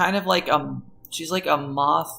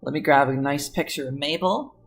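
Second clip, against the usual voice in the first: normal talking voice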